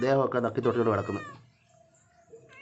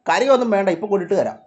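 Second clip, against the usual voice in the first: loud, raised promise; calling to pets; 7 semitones higher, 8 dB louder than usual. Real, not acted. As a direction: call out to impatient cats. Calls promise to waiting cats. (ഉറക്കെ വിളിച്ചുപറയുന്നു)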